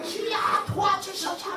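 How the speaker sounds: high-pitched cry for help to God; strained prayer plea, pitched high; demonstrated plea. Shown as a demonstration. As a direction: plead upward without full volume. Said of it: "막 이렇게".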